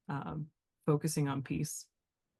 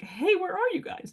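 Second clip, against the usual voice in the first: high-pitched call; 4 dB louder than usual. Retold or imitated. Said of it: "and she was like".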